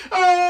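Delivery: long, loud, high scream; sustained scream of a long plunge; falling scream. Acted. Loki falls from the sky.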